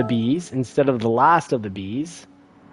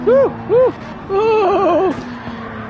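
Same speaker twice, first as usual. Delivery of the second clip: excited whoop; much slower than usual. Real, not acted. Car crash in race.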